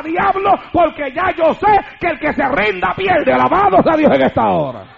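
predicador encendido, muy fuerte; prédica a pleno pulmón. Real, not acted. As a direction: proclama gritando con fervor desafiante. (sermón pentecostal a gritos)

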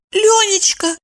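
very high, timid wife. A narrator's reading. Wife begins confession to husband, timid. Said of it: "робко призналась она".

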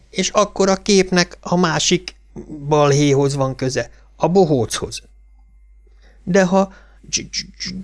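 halting, stuttered explanation; stuttering boy. A narrator's reading. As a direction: stammer out the argument, catching on first sounds.

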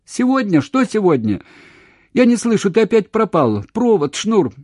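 very high, loud frantic questions; panicked shouting. A narrator's reading. Woman losing him again, panicked shouting. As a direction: cry out as the connection fails.

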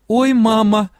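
a hint of a frightened moan; moaning despair. A narrator's reading. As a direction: groan it out as if all is lost. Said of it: "уже простонала я".

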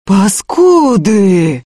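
long drawn-out outraged cry; stretched-out angry yell; yelled insult. A narrator's reading. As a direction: roar the insult slowly. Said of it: "заорал Ефим".

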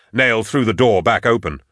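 plain reading voice

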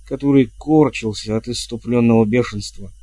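usual speaking voice

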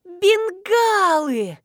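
high, long-drawn cry of dismay; gasped exclamation. A narrator's reading. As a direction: draw the word out in shocked realisation. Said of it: "ахнула Марина".

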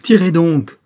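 loud, high desperate shout; crying out. A narrator's reading. Croisenois can bear the wait no longer, shouting. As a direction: shout it as an exasperated command. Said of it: "cria-t-il".

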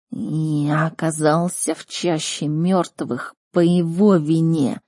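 slow, drawn-out creature voice; stretched, grave beast speech; talking beast. Acted. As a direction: confide it heavily, like a wronged animal. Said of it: "произнесло животное".

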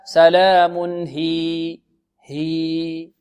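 سلام هي slow, final hī stretched; stop with madd. Shown as a demonstration. Teacher modelling the pause, long vowel in هي. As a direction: draw out هي and stop on it. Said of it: "إذن الوقف عليها".

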